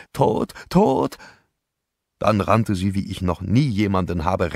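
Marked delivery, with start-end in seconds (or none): 0.1-1.2 crying out. Acted.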